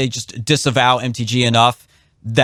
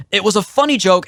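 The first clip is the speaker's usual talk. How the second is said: higher, pressing hard on "funny"; emphatic praise; 8 semitones higher than usual. Real, not acted. Host defending Boebert's joke, emphatic and higher. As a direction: insist that "funny joke" is true. Insisting the joke was fine.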